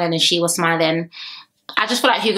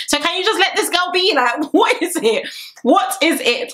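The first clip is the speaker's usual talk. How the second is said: exasperated; 9 semitones higher than usual. Pretend. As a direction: scold her in amused exasperation. Exasperated at Jessie.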